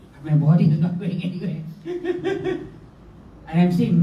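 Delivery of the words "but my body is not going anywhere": normal talking voice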